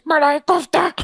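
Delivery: high, hammed-up waiter echo; waiter impression. Waiter repeating the diner's order, hammed-up high voice. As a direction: sing out the dish in a high put-on voice. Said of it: "And he goes".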